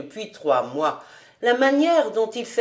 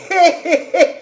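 put-on high old man's "hé hé" laugh; raised, chuckling laughter; 8 semitones higher, 7 dB louder, faster than usual. A narrator's reading. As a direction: cackle with amusement. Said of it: "Il riait".

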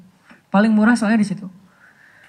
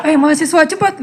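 higher mock call to hurry; 7 semitones higher than usual. Bus driver hurrying students running after him, calling out. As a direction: imitate a driver teasing students along.

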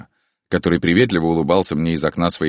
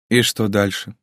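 a higher, mocking question; lightly mocking woman's question; 6 semitones higher than usual. A narrator's reading. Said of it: "насмешливо поинтересовалась женщина". (насмешливо)

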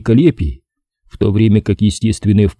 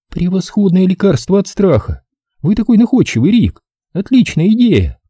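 put-on delighted girl voice; 10 semitones higher than usual. A narrator's reading. Katie delighted, high.

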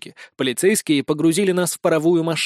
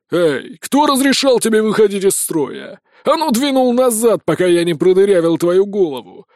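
a louder, higher furious bark; barking sheriff; 4 semitones higher, 5 dB louder than usual. A narrator's reading. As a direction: shout the recruit back into line. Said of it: "рявкнул шериф". (громко рявкнуть, зло)

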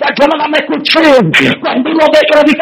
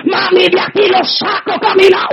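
higher, rising preacher's cry, shouting praise; fiery preaching; 4 semitones higher, 4 dB quieter than usual. Real, not acted. Preacher testifying to the congregation, full-force preaching. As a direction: preach it out with building fervor.

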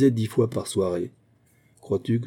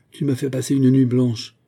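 higher, louder scolding, understated; bad-tempered; 4 semitones higher, 5 dB louder than usual. A narrator's reading. Teacher berates his pupil after a sleepless night, mildly bad-tempered. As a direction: scold with a touch of ill humour. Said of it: "avec autant de mauvaise humeur".

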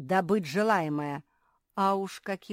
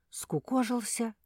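plain reading voice

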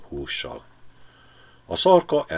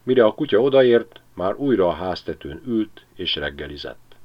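plain reading voice

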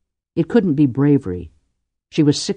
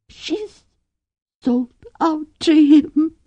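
high, shaking with grief and disgust; a young woman fighting tears; shaky grieving whisper; 3 dB louder, much slower than usual. Acted. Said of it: "Drushnikovi whispered, her voice shaking with grief".

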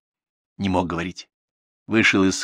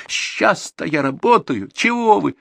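high, drawn-out wounded protest; through tears; 12 semitones higher, 4 dB louder, slower than usual. A narrator's reading. Valentina protests to the manager, through tears. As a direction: plead as if crying, pitch way up. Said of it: "проговорила сквозь слёзы".

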